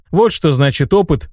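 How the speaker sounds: ordinary delivery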